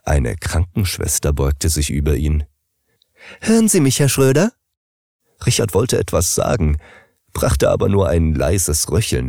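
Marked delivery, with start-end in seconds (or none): 3.4-4.5 much higher, bright call to wake him; nurse calling the patient. Acted. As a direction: gently rouse the patient. Nurse calls to waking patient.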